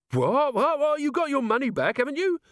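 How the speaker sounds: very high, hurried protest; panicked protest. A narrator's reading. Thief protests to the furious baker, panicked. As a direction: yelp back in bewildered alarm.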